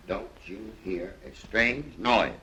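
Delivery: slow, word-by-word delivery at normal pitch; measured cue line. Shown as a demonstration. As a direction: lay out the cue slowly, without raising the voice. Explaining the line to be rehearsed.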